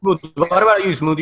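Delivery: usual speaking voice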